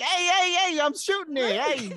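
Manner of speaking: high, loud Brooklyn shout; excited cartoon Brooklyn warning; Joey Wheeler. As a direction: holler it in a hammed-up Brooklyn accent. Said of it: "doing my Joey Wheeler Brooklyn accent".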